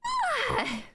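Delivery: sharp high yelp; sudden yelp. Real, not acted. Streamer yelping while yawning on stream. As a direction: yelp out in surprise. Startled yelp between sentences.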